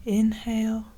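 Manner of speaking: measured, spacious breathing-exercise guidance; guided meditation. Acted. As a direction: guide listeners steadily through each breath, with long pauses.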